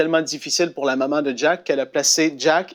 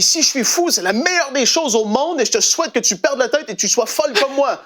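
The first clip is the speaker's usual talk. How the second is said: much higher, passionate; fervent retort; 9 semitones higher than usual. Retold or imitated. Son answering his mother with joyful fervor, higher. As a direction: fire it back with joy. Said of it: "Il dit".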